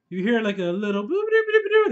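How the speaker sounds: high sing-song imitation of a truck jingle; ice cream truck tune. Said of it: "That's the damn ice cream truck".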